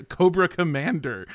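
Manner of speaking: ordinary talk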